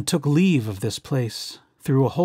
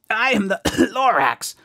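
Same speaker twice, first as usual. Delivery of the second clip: higher voice broken by a cough; coughing Lorax; 6 semitones higher than usual. Acted. Lorax choking on smog while announcing himself, coughing and higher. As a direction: cough your way through the name. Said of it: "He coughed and he whiffed".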